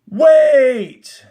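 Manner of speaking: sudden high shout of "Wait!"; exclaimed. Acted. Teacher voicing the warden's sudden outburst. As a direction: blurt it out loud and high. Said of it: "The warden exclaimed".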